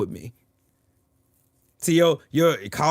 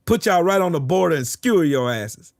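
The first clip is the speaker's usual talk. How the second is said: ordinary talk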